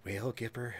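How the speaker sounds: put-on folksy presidential voice; Ronald Reagan. Shown as a demonstration. Showing off the voice trick between laughs, Reagan impression. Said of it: "Ronald Reagan voice".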